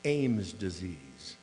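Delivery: "Ames disease" said very slowly and low; slow deliberate naming of "Ames disease"; coined phrase. Shown as a demonstration. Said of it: "I coined a little phrase".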